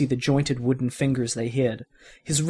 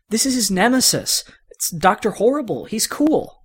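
put-on eager kid; 8 semitones higher than usual. Andy voiced explaining his hero.

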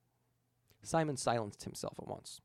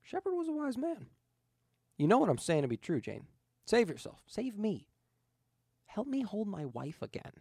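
begging ghost; 8 semitones higher than usual. Acted. Ghost begs Jayne.

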